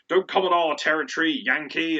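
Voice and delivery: lightly acted defiant local hero; plucky British wrestler. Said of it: "plucky British guy who was like".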